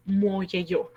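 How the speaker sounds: "mo yeyo" drawn out very slowly as one word; slow pronunciation demo. Shown as a demonstration. Teacher showing students "mo yeyo" is said joined. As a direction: run "mo yeyo" together, slow and even. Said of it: "es todo junto".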